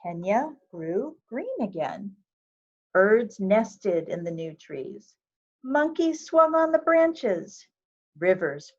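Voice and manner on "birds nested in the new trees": ordinary delivery, nothing marked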